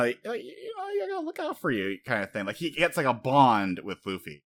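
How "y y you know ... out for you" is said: put-on a stammering young Ace, pitched up high; high kid voice. Ace as a kid reassuring little Luffy, in a high stammering voice. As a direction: play the eager older brother, voice way up.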